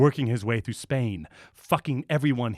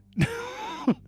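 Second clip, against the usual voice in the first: high squealing laugh. Real, not acted. Bursts out laughing.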